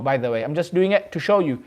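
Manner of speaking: ordinary delivery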